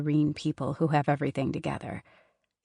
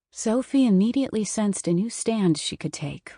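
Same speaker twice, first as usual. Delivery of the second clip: ordinary delivery, nothing marked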